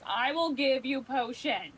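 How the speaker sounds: high, loud, drawn-out mock declaration; hammed-up grudging offer. Reluctant captain offers her crew a potion, hammed-up grudging. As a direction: stretch it out with playful reluctance.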